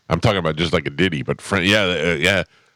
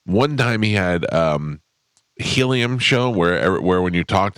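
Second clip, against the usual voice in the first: ordinary talk, nothing marked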